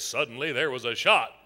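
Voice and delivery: hushed-down suspense line; ominous story narration. Shown as a demonstration. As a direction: drop low for the dramatic cue. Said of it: "I'm gonna say these words".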